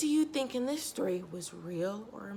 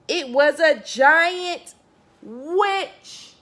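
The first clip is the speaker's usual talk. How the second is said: dramatic reveal; 7 semitones higher, 10 dB louder, much slower than usual. A narrator's reading.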